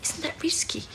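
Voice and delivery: high, soft, half-whispered disbelief; hushed alarm. Acted. Colleague questioning a risky appeal to Churchill, hushed alarm. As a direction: drop to an alarmed near-whisper.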